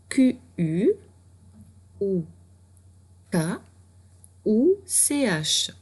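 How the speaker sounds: lettres posées une à une, lentement; épeler C H avec soin. Shown as a demonstration. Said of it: "épelle les lettres". (épeler lentement comme une maîtresse)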